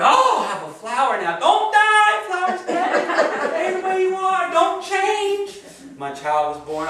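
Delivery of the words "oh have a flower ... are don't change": mock clinging plea; much higher, much louder, shrieking and pleading with a laugh. Beg the flower hysterically not to die.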